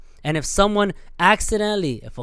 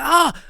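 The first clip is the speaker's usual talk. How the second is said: a much higher, sharp yelp; startled yelp; 12 semitones higher, 5 dB louder than usual. Retold or imitated. Vlogger reenacting being scared by a bike, sharp yelp. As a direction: yelp like you just got spooked. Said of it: "I'm like".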